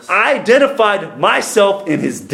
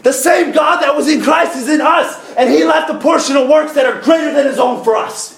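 full-force preaching; 6 semitones higher than usual. Real, not acted. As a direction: preach it out to the congregation.

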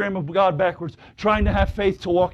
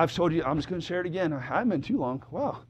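usual speaking voice

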